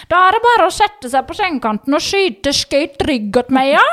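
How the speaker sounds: doctor in a thick Gudbrandsdal dialect. Retold or imitated. Doctor instructing the patient, Gudbrandsdal dialect impression. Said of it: "ikke god på Jenny i den her dialekta".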